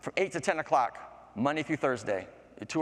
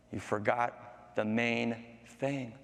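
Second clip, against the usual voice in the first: slow, soft, spaced-out warning; ominous reminder; 3 semitones lower, 5 dB quieter, much slower than usual. Acted. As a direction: draw it out softly, word by word. Flower warns the greedy man.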